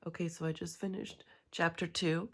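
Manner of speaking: ordinary talk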